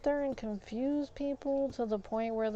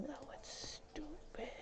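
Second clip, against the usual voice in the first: hushed, slow whispered grumble; whispering; 6 semitones higher, 15 dB quieter, slower than usual. Real, not acted. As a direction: whisper it under your breath. Grumbling to himself while playing.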